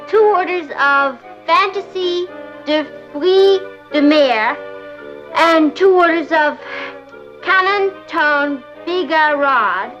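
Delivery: slow, halting French dish names; careful sounding-out. Acted. Girl ordering French dishes by phone, haltingly careful. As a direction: pick through the foreign words slowly.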